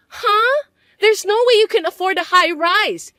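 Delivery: high, loud disbelieving outburst; shocked huh. Acted. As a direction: squeal in disbelief.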